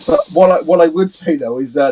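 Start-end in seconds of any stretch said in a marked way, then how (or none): none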